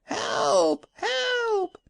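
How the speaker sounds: very high, crying call for help; wild shouting. A narrator's reading. Man shouts wildly to travellers for help. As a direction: shriek for help, beside yourself. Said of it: "shouting wildly".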